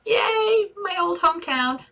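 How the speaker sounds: very high, excited squeal of a cheer; delighted cheer. Real, not acted. Cheers at her hometown's mention.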